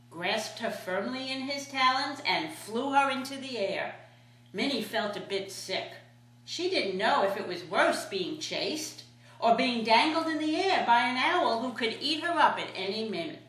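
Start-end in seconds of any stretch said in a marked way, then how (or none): none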